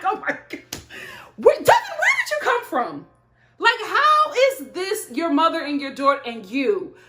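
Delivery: high, loud startled outburst with a yelp on Devin; shocked disbelief. Retold or imitated. Reacting to Devin's family on the show, shocked high disbelief. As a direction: gasp it out in total disbelief.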